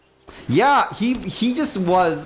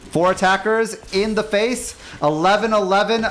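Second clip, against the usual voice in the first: high, loud, hyped-up battle call; triumphant attack call; 6 semitones higher, 3 dB louder than usual. Real, not acted. Gamer announcing the dino attack, hyped and triumphant. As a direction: build to a big, high-pitched roar of triumph.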